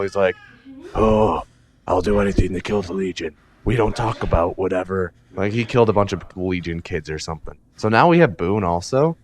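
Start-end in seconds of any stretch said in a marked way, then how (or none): 0.9-5.1 put-on throaty voice of misery; mock miserable avenger. Imitating Boone vowing revenge on the Legion, mock miserable. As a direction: imitate a brooding, miserable soldier. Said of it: "that's why Boone's so miserable".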